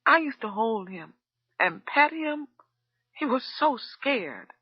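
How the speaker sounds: a mild sob through the words; sobbing. A narrator's reading. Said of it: "hardly audible under the sobbing".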